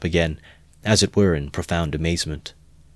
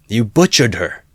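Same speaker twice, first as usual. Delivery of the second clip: louder outraged cry; accusing shout; 4 semitones higher, 8 dB louder than usual. A narrator's reading. Onlooker accusing Mikolka, outraged shout. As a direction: hurl the accusation. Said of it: "someone shouted in the crowd".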